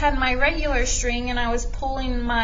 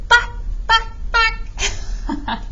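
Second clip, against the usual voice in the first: higher, imitating a hen's bawk; chicken clucks; 10 semitones higher, 3 dB louder, much slower than usual. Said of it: "Almost sounds like a chicken".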